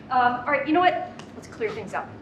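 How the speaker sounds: usual speaking voice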